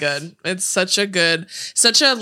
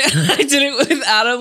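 ordinary talk, nothing marked

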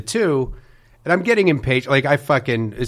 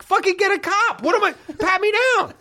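high, strained yell; mock furious scream. Retelling yelling at the TSA supervisor, high scream. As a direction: shriek it in exasperation.

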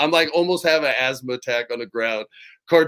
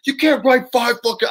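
higher-pitched mock outrage; heated, raised-pitch retold rant; angry complaint; 11 semitones higher, 3 dB louder than usual. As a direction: imitate an exasperated wrestler protesting. Retelling Big Show's angry complaint.

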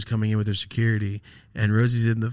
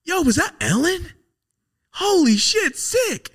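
very high and louder, starstruck squeal; excited mock yell; 6 dB louder, slower than usual. Telling friend about seeing a celebrity, high excited squeal. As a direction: shriek it like spotting a celebrity. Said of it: "And I'm like".

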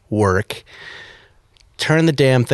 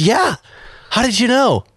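much higher, amazed question; surprised old man; 10 semitones higher than usual. Retold or imitated. Old man amazed McQueen guess was right, much higher. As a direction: sound stunned he guessed right. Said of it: "And he goes".

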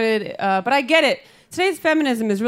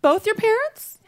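high-pitched mock disbelief; incredulous woman; 12 semitones higher than usual. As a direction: question it in a squeaky put-on voice, rising. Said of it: "she was like".

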